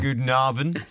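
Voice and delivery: a hint of a German accent in the greeting; mock German accent. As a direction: greet them like a hammed-up German host. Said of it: "I'm still German".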